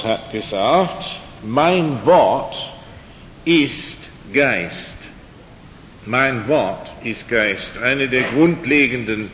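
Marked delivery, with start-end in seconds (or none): none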